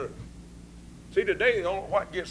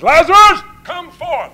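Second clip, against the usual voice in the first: high, loud call of Lazarus; commanding cry; 11 semitones higher, 19 dB louder, slower than usual. Acted. Jesus calling Lazarus out of the grave, commanding shout. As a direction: call him out of the tomb.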